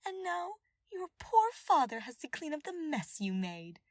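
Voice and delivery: high, loud gloating sneer; the advisor gloats over the prisoner's father, high and loud. Acted. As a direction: twist the knife with cruel delight. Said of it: "The advisor continued gloating".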